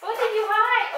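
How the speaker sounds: high playful coo. Real, not acted. Asking her dog what it hid, high baby talk. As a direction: tease the pup in a sing-song voice.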